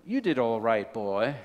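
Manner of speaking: put-on low, understated verdict from an older preacher; low-pitched senior pastor. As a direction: drop it low, grudging praise to a junior. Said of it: "pastor looked at him and said".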